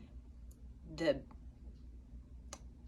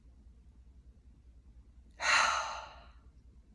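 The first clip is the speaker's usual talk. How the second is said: long, airy exhale of relief; sigh of relief; 4 dB louder than usual. Shown as a demonstration. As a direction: draw a breath, then let it go with relief. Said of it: "Kinda like a sigh of relief".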